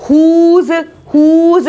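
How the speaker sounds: हुज with an H, no W; silent W. Shown as a demonstration. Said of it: "ह की आवाज आ रही है इसमें".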